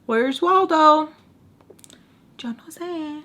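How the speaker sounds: high, loud and slow; mock obliviousness. Acting clueless toward the jealous ex. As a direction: play dumb, sing-song. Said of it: "I was just like".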